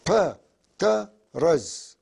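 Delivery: "ب، ت، رز" broken into its parts, very slow; split into syllables. Shown as a demonstration. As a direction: spell it out piece by piece, slowly. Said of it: "عم بقطعوا الكلام مشان سهولة اللفظ". (تقطيع "ب، ت، رز" مقطعًا مقطعًا ببطء)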